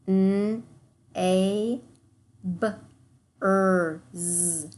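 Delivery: N, I, N, T, E, N said as separate sounds; segmenting sounds. Shown as a demonstration. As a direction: sound out nineteen piece by piece. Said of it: "segment the rest of our words".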